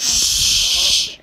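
sharp loud shh to hush someone; loud shush. Real, not acted. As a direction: shush them hard. Hushing the interrupting voice.

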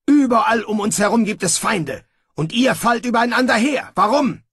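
high, loud furious rebuke; angry scolding. Acted. Snibral rebuking fighting soldiers, angry shouting. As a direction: tear into them.

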